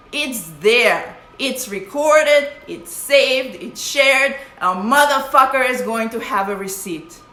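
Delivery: real emotional rant about social media receipts; heated outburst. Real, not acted. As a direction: hammer each short phrase home, worked up. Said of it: "I'm sorry I got emotional".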